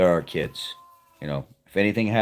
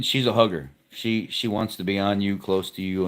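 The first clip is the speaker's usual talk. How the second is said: usual speaking voice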